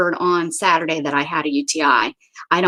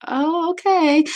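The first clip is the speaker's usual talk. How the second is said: nonchalant cat voice; 8 semitones higher, slower than usual. Retold or imitated.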